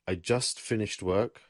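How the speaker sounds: ordinary talk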